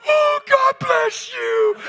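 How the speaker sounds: very high, slow, loud blessing; woman thanking the driver she hit; grateful cry. Retold or imitated. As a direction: cry out in tearful relief. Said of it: "Watch what she did".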